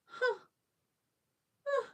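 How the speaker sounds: very high, loud shocked huh; stunned. Retold or imitated. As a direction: gasp it out in disbelief. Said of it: "And I was like".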